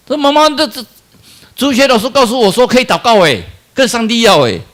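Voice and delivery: put-on child tugging at mother to go pray; eager child. Child begs mother, pleading child voice.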